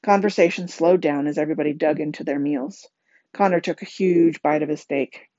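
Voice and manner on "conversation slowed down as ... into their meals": ordinary delivery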